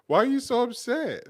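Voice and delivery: high, loud, half-laughing plea; pleading protest. Real, not acted. Asking an upset partner what is wrong, pleading protest. Playful on-air quarrel with partner.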